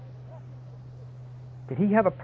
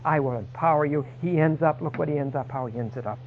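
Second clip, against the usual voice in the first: ordinary delivery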